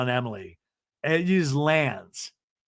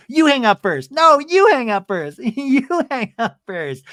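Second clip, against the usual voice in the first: high, sing-song cutesy bickering; mock lovey-dovey; 9 semitones higher, 6 dB louder than usual. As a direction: ham up the sweet tug-of-war. Mock lovers' phone goodbye.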